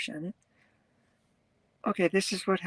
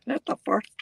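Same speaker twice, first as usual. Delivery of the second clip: laughing at the mispronunciation; 11 semitones higher than usual. Shown as a demonstration. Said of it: "supposed to be S T, not T H".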